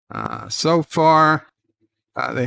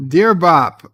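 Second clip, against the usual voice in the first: letter opener; 5 semitones higher, 8 dB louder than usual. Acted. Reading listener letters aloud.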